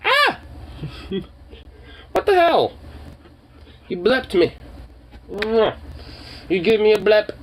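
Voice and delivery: ordinary delivery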